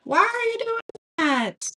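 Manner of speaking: mock yelling. Viewers yelling at the crafter over her mistake, high mock yell. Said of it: "might have been yelling at me".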